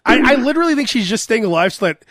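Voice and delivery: ordinary talk, nothing marked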